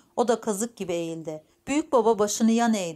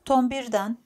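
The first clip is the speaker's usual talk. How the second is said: ordinary delivery, nothing marked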